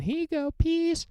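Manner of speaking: jokey falsetto goodbye; high mock send-off to listeners; silly high sign-off. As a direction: squeak the farewell up high.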